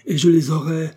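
defiant. A narrator's reading. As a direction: swear he will have them. Son demands the diamonds. (défi furieux, plus aigu et plus fort)

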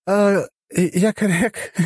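high, slow, slurred hesitation; drunk goddess voice. Acted. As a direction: fumble for the word, tipsy.

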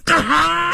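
high, drawn-out scream of pain; agonised scream. A narrator's reading. Feng-ge cut while blocking the blade, screaming in agony. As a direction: scream out as if slashed. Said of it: "就听一声惨叫".

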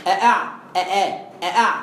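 "أأع، أآ، أأع" with two distinct glottal stops in each; both hamzas pronounced. Shown as a demonstration. Teacher shows how Shu'ba reads the word, both hamzas pronounced. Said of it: "شعبة مثلًا يقرأها".

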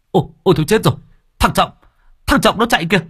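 high, loud panicked shrieking; panicked cry. Acted. Woman screams at the crowd to chase Trọng, panicked. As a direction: scream after him in alarm, voice cracking high.

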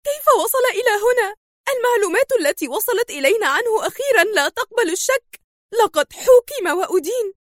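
high-pitched, tense agitated voice; extreme tension. A narrator's reading. Villainess demands how he got here, extremely tense. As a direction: pitch it up, wound tight with tension. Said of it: "في توتر شديد".

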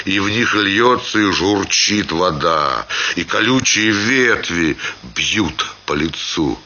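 ordinary delivery

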